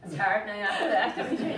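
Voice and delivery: high, loud laugh through the words. Real, not acted. Honouree answers after an emotional tribute, laughing. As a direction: laugh as you start to speak.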